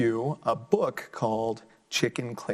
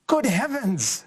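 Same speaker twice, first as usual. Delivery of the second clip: high, startled farmer exclaiming; astonished farmer; 9 semitones higher, 4 dB louder, slower than usual. Acted. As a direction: squeal it like a stunned farmer. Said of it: "said the farmer".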